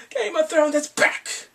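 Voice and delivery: loud, high, thrilled announcement; excited shout. Real, not acted. Fan shouting to viewers that the show has returned, thrilled. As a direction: burst out with joy that the show is back.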